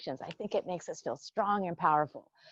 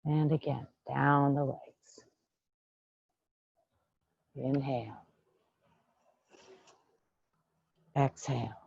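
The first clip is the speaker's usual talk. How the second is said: calm qigong teacher voice; guided meditation. Acted. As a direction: lead them gently through the breath. Leads a breathing meditation.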